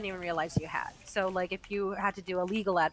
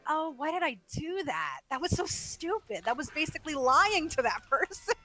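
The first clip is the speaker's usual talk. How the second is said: self-scolding; 6 semitones higher than usual. Retold or imitated. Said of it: "I'll be like".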